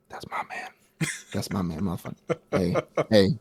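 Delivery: whispered gloating. Retold or imitated. Makima gloating over her man, whispered. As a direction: breathe it out as a hushed whisper.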